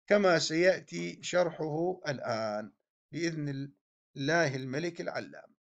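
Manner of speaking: ordinary talk, nothing marked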